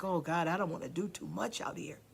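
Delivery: a meek, self-doubting murmur; mock timid voice. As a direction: whisper your own doubt to yourself. Mock timid inner voice quoted.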